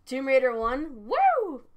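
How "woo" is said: excited whoop. Real, not acted. Cheering for Tomb Raider.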